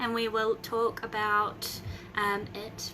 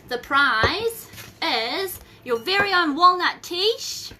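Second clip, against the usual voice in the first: excited announcement; 7 semitones higher, 7 dB louder, slower than usual. Real, not acted. Announcing the prize.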